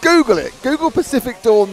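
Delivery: loud, high mock command; hammed-up angry order; mock aggressive. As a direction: imitate an annoyed chat message. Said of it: "It's got very aggressive".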